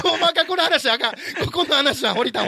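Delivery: high, loud hurried protest; flustered cutting-off. Real, not acted. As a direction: urgently call a halt to the gossip. Host cutting off risky gossip.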